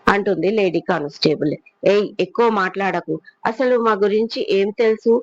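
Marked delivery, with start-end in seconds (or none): none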